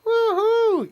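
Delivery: loud woo-hoo. Real, not acted. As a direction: whoop back in answer.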